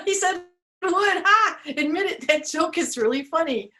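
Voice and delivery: high playful tree insisting; cheeky tree winking at the crowd; joking tree. Acted. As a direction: insist the joke is funny, laughing. Tree insists joke funny.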